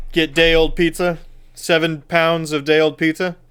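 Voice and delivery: normal talking voice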